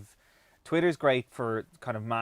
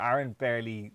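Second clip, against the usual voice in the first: normal talking voice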